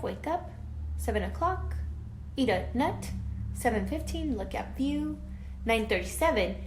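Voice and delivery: lively, expressive read-aloud voice; reading to children. A narrator's reading. Teacher reading a story to her class, lively and expressive. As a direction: bring the picture book alive for the class.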